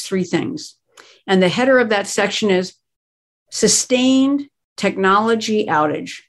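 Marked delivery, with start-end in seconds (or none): 3.5-6.2 "sustained" leaned on and drawn out, slow; slow, deliberate "sustained technology outage" with weight on "sustained"; stress on sustained. Shown as a demonstration. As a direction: hit the word "sustained" hard, then say the rest slowly. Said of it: "I want to emphasize the word sustained".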